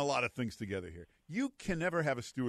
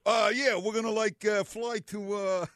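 higher, louder, hesitant pilot voice; unsure pilot announcement; 10 semitones higher, 7 dB louder than usual. Retold or imitated. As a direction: fumble the announcement like a clueless pilot. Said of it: "the pilot never comes on and says".

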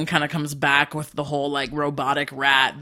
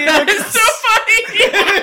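high laughing squeal; 5 dB louder, slower than usual. Real, not acted. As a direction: laugh through the words. Laughing at Face Off news.

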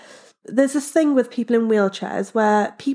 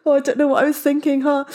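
higher, gushing nervous thanks; flustered thanks; 5 semitones higher than usual. Retold or imitated. Thanking the lobby men after being freed, flustered and higher. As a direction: thank them all in a flustered rush. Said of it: "I was obviously really flustered".

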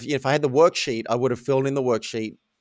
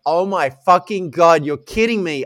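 loud, higher retold outburst; reliving an incredulous outburst; exasperated disbelief; 4 semitones higher, 7 dB louder than usual. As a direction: blurt it out in appalled disbelief. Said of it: "this was my honest mouse coming through".

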